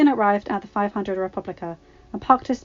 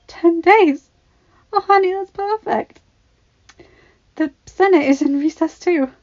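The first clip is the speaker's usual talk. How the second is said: delighted; 10 semitones higher, 9 dB louder than usual. A narrator's reading. Wife delighted at his leave.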